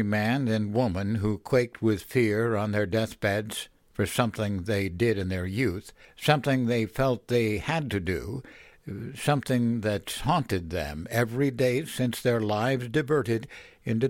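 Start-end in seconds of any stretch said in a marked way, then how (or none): none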